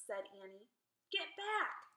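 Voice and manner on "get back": high-pitched, restrained shout; shouted warning. A narrator's reading. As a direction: call her back with a mild mock shout. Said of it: "Jack shouted at her".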